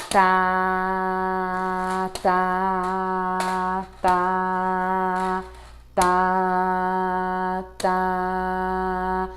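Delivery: each "tá" sustained flat for the whole beat; held rhythm syllable. Shown as a demonstration. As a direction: hold every "tá" steady on one note. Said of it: "Segurar a sílaba em todo o movimento". (cada "tá" sustentado durante todo o movimento)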